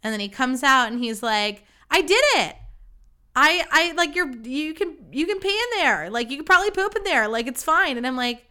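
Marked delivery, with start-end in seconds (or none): 1.9-8.0 high, loud victory announcement, acting him out; triumphant quote. Date emerging from the bathroom after plunging, acted-out triumph. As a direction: imitate an elated man proudly announcing success.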